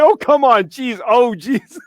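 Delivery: high, whiny imitation of an offended friend; mock offended Kip. Bandmate mimics touchy Kip, mock-offended and high-pitched. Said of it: "He's like".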